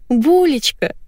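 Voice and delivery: high, sweet pet talk; burbling coo. A narrator's reading. Wife cooing to her dog by phone, burbling. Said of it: "журчала Валентина".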